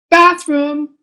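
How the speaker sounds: put-on high child's announcement; announced out loud. Child stalling at bedtime, saying it out loud. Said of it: "you say out loud".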